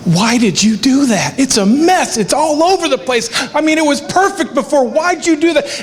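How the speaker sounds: dad voicing his daughter upset about the messy heart, high and distressed. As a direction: imitate a kid freaking out over a mess. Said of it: "she was just freaking out".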